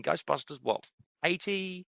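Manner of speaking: ordinary delivery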